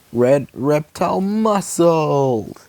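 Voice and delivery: slow, dramatic power shout; power move call. Acted. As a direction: announce the power slowly. Superpower call.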